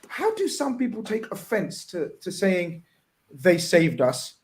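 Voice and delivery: ordinary talk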